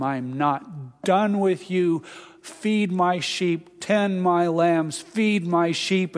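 slow, emphatic charge; deliberate, one phrase at a time; Jesus firmly commissioning Peter, slowly. Retold or imitated. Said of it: "three times Jesus commissions him".

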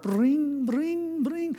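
high sung harp plink, rising; harp sound. Shown as a demonstration. Imitating cartoon heaven harp, high chiming plinks. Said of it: "little mouse ... with wings and a harp".

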